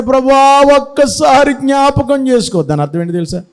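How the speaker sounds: pleading prayer. Acted. Samson prays for one last chance, high imploring plea. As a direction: plead like a sinner begging forgiveness. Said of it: "ఇప్పుడు ప్రార్థన చేస్తున్నాడు".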